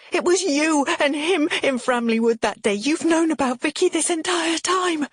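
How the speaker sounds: high, heated accusation. A narrator's reading. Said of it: "My voice rises".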